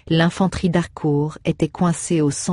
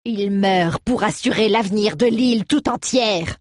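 raised, heated retort; angry outcry; 6 semitones higher than usual. Acted. Alroeg fuming at the count, raised and heated. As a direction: still fuming, snap it back. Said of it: "s'écria Alroeg, qui ne décolérait pas".

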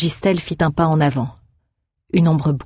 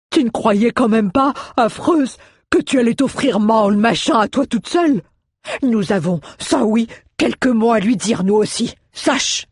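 high-pitched, scolding dwarf voice; huffy little dwarf insisting on joining the fight; indignant dwarf; 7 semitones higher than usual. A narrator's reading. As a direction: protest in a put-on high voice. Narrator voices Mjoln.

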